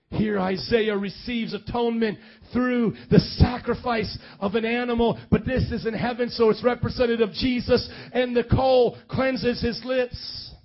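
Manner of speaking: loud, driven sermon delivery; forceful preaching. Real, not acted.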